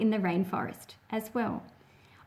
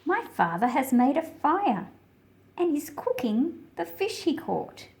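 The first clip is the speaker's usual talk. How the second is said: put-on reading to children. A narrator's reading. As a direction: read the picture book aloud to little listeners, unhurried. Reads picture book aloud.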